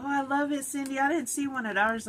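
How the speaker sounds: high-pitched happy gush; gushing delight. Real, not acted. Thanking Cindy for the bat gift, gushing in a high voice. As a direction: gush your thanks to a friend.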